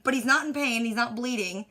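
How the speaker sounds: ordinary talk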